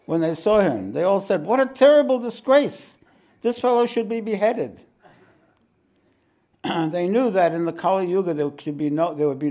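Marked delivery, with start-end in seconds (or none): 1.5-4.8 loud, high shocked outcry; outraged sages. A narrator's reading. Courtiers shocked by the naked giant, loud high outcry. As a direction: cry out in scandalised horror.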